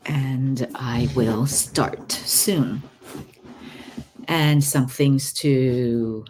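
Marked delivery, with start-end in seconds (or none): 0.1-2.8 guided meditation. Acted. Guided session opening, slow soft.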